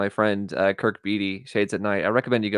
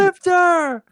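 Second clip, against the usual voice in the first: high, loud yell at a thief; acted angry shout; 5 dB louder, slower than usual. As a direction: holler it like an alarmed cop. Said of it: "He goes".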